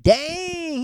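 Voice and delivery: squealed high exclamation; a high-pitched mock gasp of shock; shocked reaction. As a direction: yelp it in disbelief. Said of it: "I was like".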